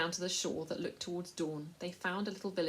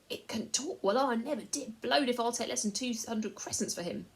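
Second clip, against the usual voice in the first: higher, louder, amazed trader; surprised merchant; 6 semitones higher, 6 dB louder than usual. A narrator's reading. Slave merchant amazed the mouse can talk, greedy surprise. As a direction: marvel like a greedy dealer.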